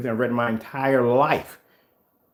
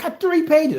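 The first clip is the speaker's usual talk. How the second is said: whiny rant, much higher; mock rant; 4 dB louder than usual. Ranting to a friend about three pages, whiny and high. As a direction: grumble like it's a mountain of work. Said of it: "And I rant to my friend".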